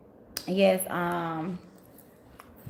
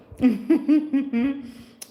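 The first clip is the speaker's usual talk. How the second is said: loud high burst of laughter; big laugh; 7 semitones higher, 7 dB louder than usual. Real, not acted. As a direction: crack up out loud at the comment. Laughs at viewer comment.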